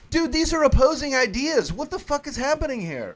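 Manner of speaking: high, exasperated disbelief; incredulous. Retold or imitated. Reacting to the guards' orders, high and exasperated. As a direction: throw up your hands at the nonsense. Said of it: "I'm like".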